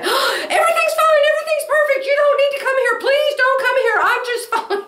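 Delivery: mother's voice pitched high, laughing, insisting all is fine; high mock cheerful mother. Daughter mimics her mother on the phone, high and laughing. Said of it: "she'll say".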